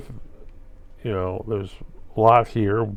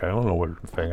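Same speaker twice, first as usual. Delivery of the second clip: usual speaking voice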